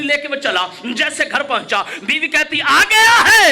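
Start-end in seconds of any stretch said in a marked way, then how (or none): 2.6-3.5 the wife calls out loudly and higher, with a laugh; loud wife's greeting. Retold or imitated. Wife greets her husband home, loud and mocking. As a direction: burst out as the teasing wife.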